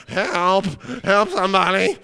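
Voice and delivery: high, loud call for help; hammed-up desperate yelp; mock panicked cry. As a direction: cry out as if overwhelmed. Acts panicked believer crying for help.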